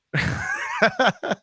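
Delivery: high-pitched embarrassed laugh. Real, not acted. Caught out over the impression.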